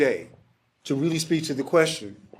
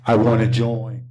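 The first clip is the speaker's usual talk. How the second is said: deadpan punchline; 4 semitones lower, 4 dB louder than usual. Retold or imitated. Punchline after excited quotes.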